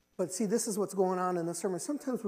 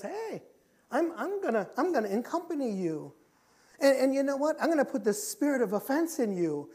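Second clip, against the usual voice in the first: lightly acted spirit, pitched up; intruding spirit; 6 semitones higher, 4 dB louder than usual. Spirit of offense moving into someone, lightly acted and high. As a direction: announce the takeover in a raised voice. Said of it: "the spirit comes in".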